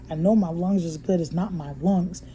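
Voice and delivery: ordinary delivery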